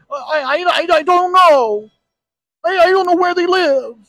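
high, stammering whine; stammering whiny victim. Retold or imitated. Cornered internet user who can't answer, high stammer. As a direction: stumble over it in a high whine.

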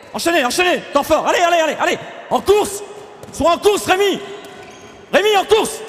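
high, urgent sideline shouting; shouted urging. Real, not acted. Coach pushing a player to keep running, shouted urging. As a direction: drive the player on, pitch climbing as you shout.

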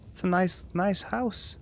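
high, sing-out voice; high-pitched coaxing. Real, not acted. Gamer reassuring himself about a creepy house, high-pitched. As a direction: pitch it way up, insisting all is well.

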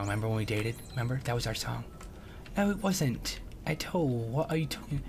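ordinary delivery

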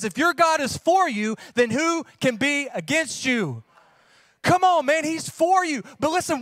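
loud, high, driving sermon voice; fiery preaching. Acted. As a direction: preach it out with full force to the congregation. Preaching at full force.

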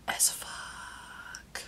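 breathy whispered curse under the breath; whispered aside. Real, not acted. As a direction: whisper the swear as a secret. Swearing aside after "splotchy.

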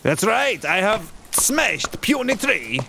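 slow, dim strongman boast; slow Russian Borat voice; Russian Borat accent. Pretend. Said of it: "kinda like a Russian Borat".

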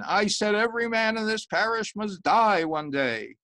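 high, loud repeated warning; insistent sermon. Acted. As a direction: drive it home to the laughing man.

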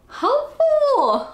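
high, slow gush. Real, not acted. Praising the planner to viewers, high slow gush. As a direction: stretch it out high and delighted.

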